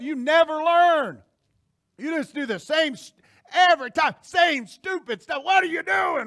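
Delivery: high, loud exasperated outburst; losing his cool. Acted. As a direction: stretch the words out in fed-up scolding. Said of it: "We would lose our cool".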